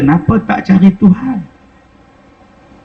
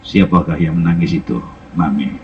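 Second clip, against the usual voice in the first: lower, softer voice of a grieving blind man; blind Kasim's voice; 4 dB quieter than usual. Retold or imitated. Blind Kasim asking the landlady who is crying, low and soft. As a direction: speak low and gentle, asking who weeps.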